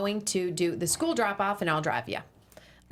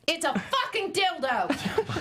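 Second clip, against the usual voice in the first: laughing fury; 7 semitones higher, slower than usual. Acted. Wife furious holding banana.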